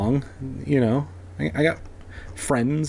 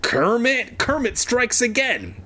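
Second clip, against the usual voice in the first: high panicked voice; 9 semitones higher than usual. Acted. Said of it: "Evan thought bitterly".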